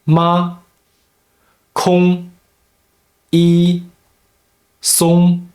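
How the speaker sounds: Ma, kong, yi, song each held high and level; first tone. Shown as a demonstration. Teacher showing Mandarin learners Ma, kong, yi, song in level, high first tone. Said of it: "pronounced in the first tone".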